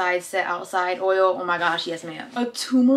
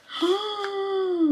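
excited gasp; 10 semitones higher, 5 dB quieter than usual. Real, not acted. Finding whitening toothpaste.

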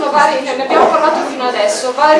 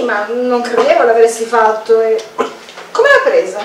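ordinary talk

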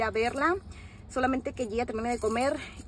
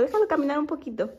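ordinary talk

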